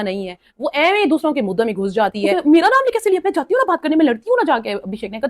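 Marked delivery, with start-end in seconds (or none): 2.5-4.7 a hammed-up girl spoiling for a fight, cooling off; mock fiery challenge. [name] insisting she'll go fight, in a mock-fiery voice that settles.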